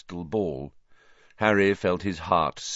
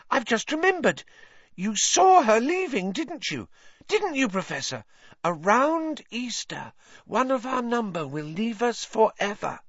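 put-on much higher, breathless eager girl voice; gushing Lavender; 5 dB louder than usual. A narrator's reading. Lavender reminding Trelawney of the prophecy, high and gushing. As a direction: gush in excited admiration.

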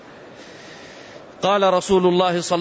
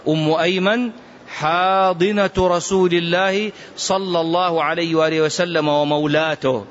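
ordinary talk

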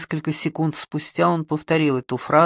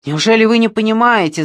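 loud, fast, higher cry; shouted; 6 semitones higher, 8 dB louder, faster than usual. Acted. Suspect shouting at detective, frantic loud cry. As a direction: shout it at him in frenzy. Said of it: "закричал Бэнксон".

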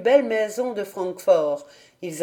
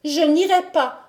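put-on high, firm child refusal; stubborn child; 7 semitones higher than usual. A narrator's reading. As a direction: refuse flatly, higher voice. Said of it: "répondit Heidi".

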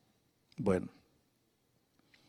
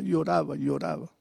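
lloraba repeated, dropping lower and softer each time; fading repetition; 5 semitones lower, 6 dB quieter than usual. A narrator's reading. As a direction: let "lloraba" fade away into a hush. Bebeğin ağlayışını anlatırken.

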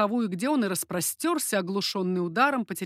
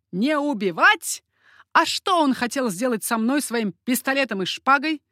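loud, raised, incredulous question; scornful exclamation; 4 semitones higher, 5 dB louder than usual. A narrator's reading. Coconnas answering the parents' plea, exclaiming in scorn. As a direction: throw the plea back, loudly. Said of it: "воскликнул Коканнас и расхохотался".

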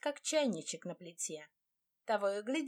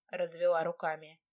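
usual speaking voice

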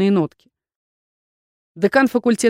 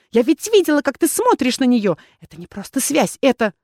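hissed accusation; 5 semitones higher than usual. A narrator's reading. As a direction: accuse him in a rising temper. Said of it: "шипела Валерита".

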